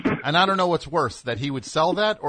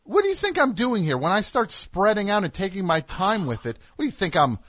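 higher-pitched exasperated rebuke; indignant scolding; 7 semitones higher than usual. Real, not acted. Host scolding co-host who spoiled his punchline, indignant. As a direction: tell off whoever stepped on your joke.

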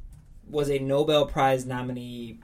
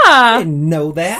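slow, louder, delighted exclamation; excited; 5 semitones higher, 14 dB louder, slower than usual. Real, not acted. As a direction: stretch it out, thrilled by the news. Reacting to Carol Channing memoir.

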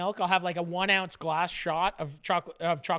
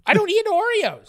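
indignant denial; 10 semitones higher, 6 dB louder than usual. Real, not acted.

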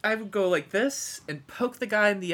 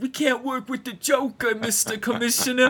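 mock cop; 6 semitones higher, 5 dB louder than usual. The cop pleading with Jim Gordon, hammed up. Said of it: "He's like".

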